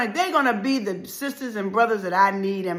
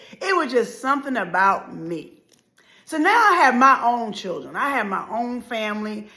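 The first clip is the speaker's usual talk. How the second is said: ordinary talk, nothing marked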